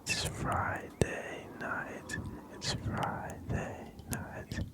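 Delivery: drawn-out whisper, word by word; lingering ASMR whisper, stretching each word; slow whispered reading. Real, not acted. Reads cup's writing slowly, twice.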